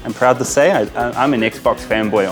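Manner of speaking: ordinary talk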